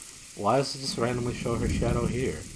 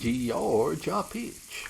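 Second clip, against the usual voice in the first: slow, drawn out Georgia peach; stretched out slowly, savoring; slow drawl; 5 semitones higher, much slower than usual. Pretend. Drawling drink name, slow.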